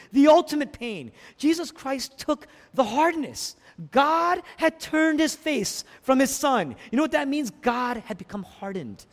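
high, ringing pulpit intensity; full-force preaching. Real, not acted. Preacher tells how God turned away, full-force preaching. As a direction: proclaim it with rising passion.

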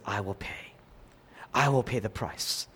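low, soft, resolved promise; quiet resolve. Retold or imitated. Preacher voicing Jesus offering to pay, low and soft. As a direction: say it softly, firmly. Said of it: "Jesus says".

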